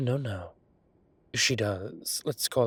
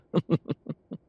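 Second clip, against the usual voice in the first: laugh; 6 semitones higher, 3 dB louder than usual. Acted. Laughs after teasing Gavin.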